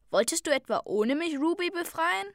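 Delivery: mock-offended challenge; reproachful question. A narrator's reading. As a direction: catch a friend sneaking off. Confronting friend at ship.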